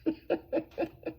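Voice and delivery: soft, very high giggle; light tittering run of laughter; giddy laugh. Real, not acted. Laughs, overcome by the feeling.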